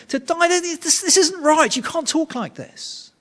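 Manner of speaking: high-pitched, incredulous pleading; disbelieving protest. Acted. Peter objecting to Jesus's talk of dying, disbelieving protest. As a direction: protest as if none of it makes sense. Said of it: "he just cannot compute it".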